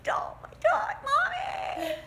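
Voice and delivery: put-on high, shrieking child's cry for Mommy; child wailing for mommy. Helpless victim child shrieking for mommy, high wail. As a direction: wail like a helpless little kid.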